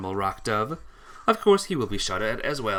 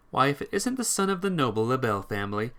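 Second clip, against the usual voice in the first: ordinary talk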